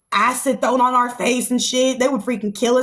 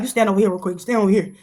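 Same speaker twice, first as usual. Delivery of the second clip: low, smooth, flirty Trump voice; imitating flirty Trump; 3 semitones lower than usual. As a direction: lower and sly, a flirty Trump. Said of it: "He be like".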